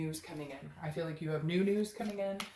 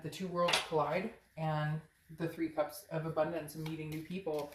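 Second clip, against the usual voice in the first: ordinary talk, nothing marked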